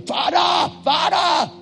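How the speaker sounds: high shouted cry of Father; mock prayer shout. Preacher mimics a frantic deliverance prayer, high shout.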